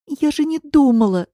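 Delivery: high, plaintive protest; acted tearful self-defence. A narrator's reading. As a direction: wail it as if starting to cry. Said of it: "заплакала Катя".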